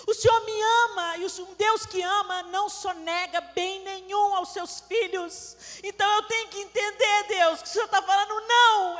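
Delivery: loud, high fervent sermon voice; fervent preaching. Acted. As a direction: proclaim it to the congregation with fire. Preaching repentance at full force.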